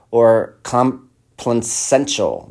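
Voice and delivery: "complaisant" spelled out slowly, syllable by syllable; careful slow pronunciation. Shown as a demonstration. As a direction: sound out "complaisant" with great care. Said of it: "That's a hard word to say".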